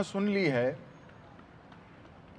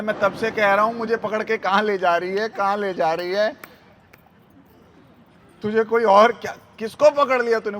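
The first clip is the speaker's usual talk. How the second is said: loud, higher indignant complaint; भड़का पति; 3 semitones higher, 6 dB louder than usual. Retold or imitated. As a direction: protest at being dragged along, in mock outrage. घसीटा गया पति भड़कता है. (ज़ोर से शिकायत करता पति)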